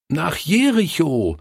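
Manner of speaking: high-pitched, eager call; children calling out. A narrator's reading. Children reminding the forgetful Pastor where, calling out. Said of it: "riefen sie".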